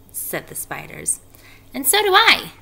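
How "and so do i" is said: high, loud little chime-in; Nellie's voice. A narrator's reading. Nellie joins the pledge to Wilbur, high piping voice. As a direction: pipe up brightly at high pitch. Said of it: "said Nellie".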